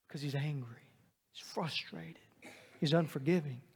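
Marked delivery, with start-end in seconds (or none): 0.1-2.2 whispered. Acted. Describing the brother, dropped to whisper.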